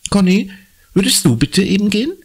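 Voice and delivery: high, quick motherly request; mother's voice. A narrator's reading. Mama bittet Conny schnell, hoch und zügig. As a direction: ask Conny to go, briskly.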